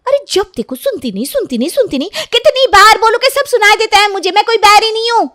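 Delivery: fed up, shout back at mom; angry teen girl. Acted. Sunita's voice in a quarrel. (गुस्से में चिल्लाती लड़की, बहुत ऊँची आवाज़)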